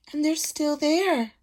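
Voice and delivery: put-on high squeaking baby bear; squeaky Baby Bear. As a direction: squeak like a tiny cub. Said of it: "squeaked Baby Bear".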